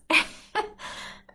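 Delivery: high giggling fit; burst of laughter. Real, not acted. Remembering all these funny moments, breaks into high laughter. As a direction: dissolve into real laughter.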